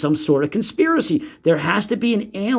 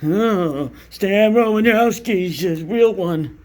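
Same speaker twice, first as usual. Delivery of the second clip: mock filmer; 4 semitones higher, slower than usual. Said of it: "what he's purposely doing is ... and".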